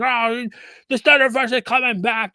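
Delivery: mock the fans' hopeful claim. Mocking fans who insult critics.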